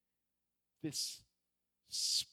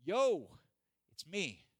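lightly voiced casual call, "yo" punched; casual Jesus; 5 semitones higher, 10 dB louder, much slower than usual. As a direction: call out a breezy greeting. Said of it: "imagine Jesus saying".

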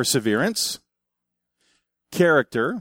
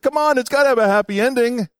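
indignant protest; 8 semitones higher than usual. Retold or imitated. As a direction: beg the book for a happy ending. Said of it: "I was like".

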